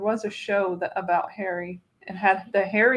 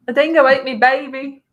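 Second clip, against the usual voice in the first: loud, higher mock Australian accent; 4 semitones higher, 8 dB louder than usual. Host proving the line needs an accent, Australian accent. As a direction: put on a hammed-up Australian accent. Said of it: "You can't say that without doing the accent".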